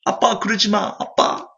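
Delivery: crying daughter. Retold or imitated. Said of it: "소원이가 울면서".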